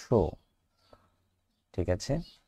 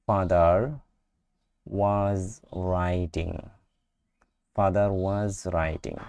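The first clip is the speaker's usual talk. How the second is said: slow model reading; much slower than usual. Shown as a demonstration. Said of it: "teacher models sentence slowly".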